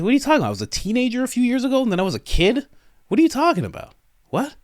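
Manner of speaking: high-pitched, mock-baffled young person; incredulous protest. Young person answers "you've had it good", incredulous.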